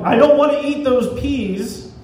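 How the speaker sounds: a child refusing peas, imitated; mock child yell. Child at the dinner table refusing peas, mock yelling. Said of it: "Their child is screaming and yelling".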